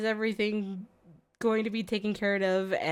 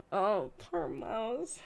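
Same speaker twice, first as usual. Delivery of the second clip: soft pity; 2 semitones higher, 10 dB quieter, much slower than usual. Real, not acted. Pitying the streamer.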